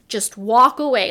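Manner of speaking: dad's final word, loud and slow; dad's firm advice. Retold or imitated. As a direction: lay down the law like a father. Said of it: "My dad always said".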